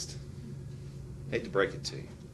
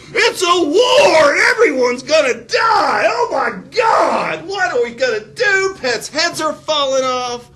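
hammed-up panicked shouting, much higher and louder; slow, shrieking mock hysteria about the war; 10 dB louder, slower than usual. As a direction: shriek it like the world is ending. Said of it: "No, they said".

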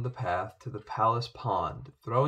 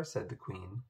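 plain reading voice

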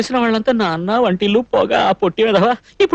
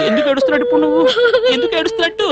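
much higher, helpless questioning; flustered distress; 12 semitones higher than usual. Acted. Man upset by the girl's crying, flustered. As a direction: let the pitch climb in fluster.

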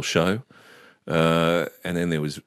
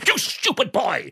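high, slow, declaimed scolding; Shakespearean mother. Retold or imitated. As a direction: declaim it grandly like a stage tragedian, drawn out. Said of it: "my mother would sort of be quite Shakespearean".

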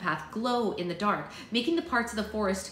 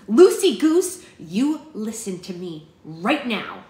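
loud commanding call, then slow and firm; scolding a runaway friend, loud and firm; stern order; 10 dB louder, slower than usual. A narrator's reading. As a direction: lay down the law to her friend. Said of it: "Bristol ordered".

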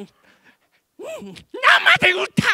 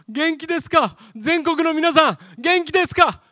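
ordinary talk

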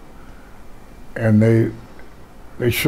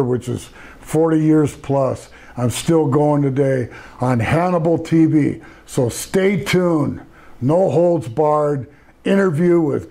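slow, raised-pitch show promo; wrestling promo; 5 semitones higher than usual. Acted. Wrestler to viewers, urging them to stay tuned, slow promo delivery. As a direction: draw out each phrase like an announcer.

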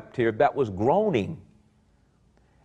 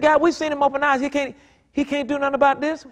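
doubting bystanders; 13 semitones higher, faster than usual. Retold or imitated. Preacher mocking the doubters at the tomb, high mocking voice. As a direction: sneer at what he can't do, pitched high. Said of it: "He said".

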